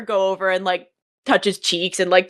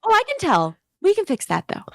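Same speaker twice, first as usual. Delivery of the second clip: high, sweet, gushing Southern mom; high chirpy mom voice, all charm; Honey the mom; 12 semitones higher than usual. Acted. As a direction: gush like an overfriendly realtor mother. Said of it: "Honey goes".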